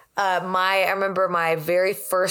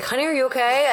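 worried partner; 8 semitones higher than usual. Retold or imitated. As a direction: call over with sudden concern. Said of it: "you're always like".

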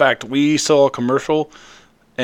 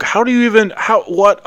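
high, incredulous disbelief at the play; baffled; 10 semitones higher than usual. Retold or imitated. Fan retelling his disbelief at Polamalu jumping the line, incredulous. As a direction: ask it like you can't believe your eyes.

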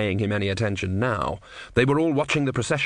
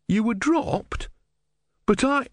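put-on much higher, astonished young monk; astonished novice; 12 semitones higher, slower than usual. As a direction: gasp it in disbelief. Brother astonished.

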